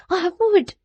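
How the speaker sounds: put-on high-pitched struggling girl; high, defiant retort. A narrator's reading. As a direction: protest while fighting to get free. Girl struggles to free herself.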